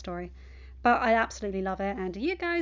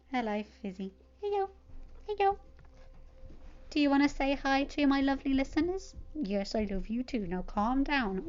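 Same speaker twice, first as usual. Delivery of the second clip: talking to her dog; 3 semitones higher than usual. Real, not acted. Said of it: "if you can hear my dog".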